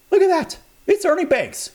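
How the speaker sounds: put-on starstruck kid. Kid spots his baseball idol.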